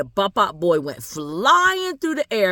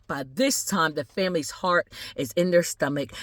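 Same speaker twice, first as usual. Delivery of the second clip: plain reading voice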